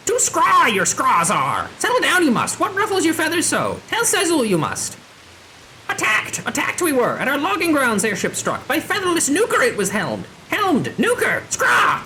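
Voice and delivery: high, swooping squawky voice; indignant squawking beastman; agitated bird creature. Acted. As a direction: play a ruffled bird-person raising the alarm.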